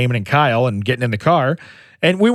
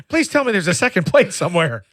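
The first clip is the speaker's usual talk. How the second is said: put-on excited kid yelling; 12 semitones higher than usual. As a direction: shout it up high like a panicked kid.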